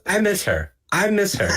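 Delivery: high-pitched mock pining; pining lament. Imitating Dio missing her all day, high pining voice. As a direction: whine it high, over and over. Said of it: "all he says all day now is".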